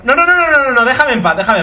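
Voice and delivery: real frantic yell; panicked shouting. Real, not acted. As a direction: fend it off in a panic. Creature harasses him again.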